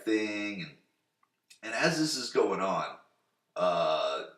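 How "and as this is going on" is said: ordinary talk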